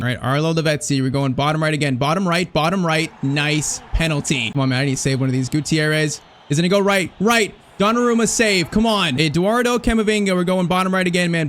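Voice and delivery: normal talking voice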